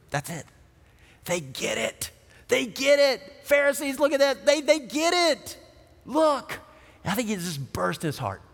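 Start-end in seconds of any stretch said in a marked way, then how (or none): 0.1-2.1 softer, lower, to himself; hushed, lower "They get it"; quiet realization. Retold or imitated. Jesus's inner love burst. 2.5-6.6 "They get it" lifted high and bright; excited delight. Retold or imitated. Jesus's delight shown to the Pharisees.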